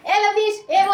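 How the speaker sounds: imitating kids yelling a name; mock playground call. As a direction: shout across the yard, playfully. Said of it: "ऐसे चिल्लाते हो".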